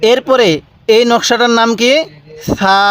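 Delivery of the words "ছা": long, gentle lisped "th" opening সা; soft সা. Shown as a demonstration. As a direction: say সা softly with the tongue at the teeth, like "th" in "thin". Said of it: "সাটা একটু নরম করে উচ্চারণ করবেন".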